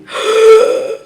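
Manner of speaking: high, sharp gasp of disbelief; shocked gasp. Real, not acted. Host reacting to "too clingy", shocked gasp. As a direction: gasp as if scandalised.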